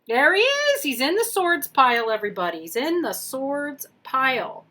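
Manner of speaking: triumphant announcement to everybody; thrilled to find the Fool; excited discovery. Real, not acted. Finds the card, announces it.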